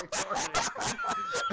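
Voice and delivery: slow words dissolving into laughter; breaking into laughter. Real, not acted. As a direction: start telling it, then lose it laughing. Cracking up telling the story.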